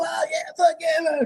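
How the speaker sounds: very high mock drunken whoop, slow; rowdy party cheer. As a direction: whoop it up like a backstage crowd. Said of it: "you hear all these people".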